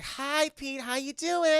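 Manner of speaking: high-pitched mock-girlish hello; imitated eager girl; gushing greeting. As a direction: greet the date in a sing-out high voice. Girl greets her date at door.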